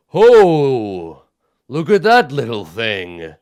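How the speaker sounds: loud, rumbling giant amused by a little creature. Acted. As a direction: bellow it like a giant spotting something tiny. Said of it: "in a deep rumbling voice".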